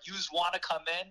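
a hint of a New York accent; mild New York accent, yous wanna come in. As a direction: lightly voice a Queens homeowner's New York accent. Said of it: "classic New Yorkers with the New York accent".

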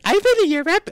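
hammed-up indignant date; mock girl's voice. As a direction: toss it off like a snippy date. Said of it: "the girl's like".

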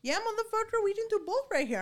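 high, pumped-up mock challenge; retold trash talk.

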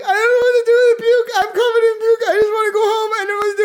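high, teary wailing mock sob; a hammed-up crying voice with a cracking wail; imitating crying friend. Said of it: "My friend is crying".

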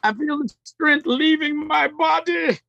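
high, strained mock death cry; hammed-up dying hero. Podcaster jokingly playing a weakened hero, hammed-up dying voice. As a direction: act out strength draining away, pitched high.

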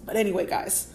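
ordinary talk, nothing marked

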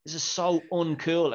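high, slow whine of disappointment; whiny complaint. Retold or imitated. Detective whining at boring case.

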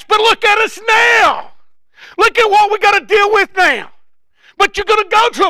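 high, loud whining Israelites; loud complaining shout. Retold or imitated. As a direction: shout their complaint at the preacher. Preacher voicing complaining Israelites.

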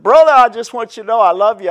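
high, loud syrupy brotherly love; imitating a phony well-wisher, high and loud; mock sugary greeting. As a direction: lay the fake sweetness on thick. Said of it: "they said".

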